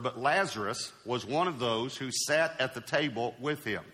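soft, measured Bible reading; hushed, reverent reading of scripture. Acted. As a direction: read the passage quietly to the church. Reads scripture passage.

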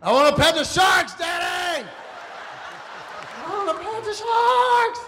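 put-on high, loud, eager little boy shouting; excited child. Father voicing his fearless son begging to pet sharks. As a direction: yell with a kid's thrill. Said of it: "a rough and tumble Cody".

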